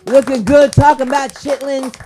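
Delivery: loud hype for the comic. Real, not acted. Host praises comic, a loud hype call. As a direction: pump up the room.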